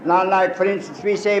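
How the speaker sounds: usual speaking voice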